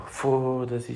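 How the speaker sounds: usual speaking voice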